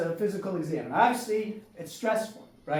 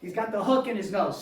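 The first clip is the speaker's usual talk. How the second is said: ordinary talk, nothing marked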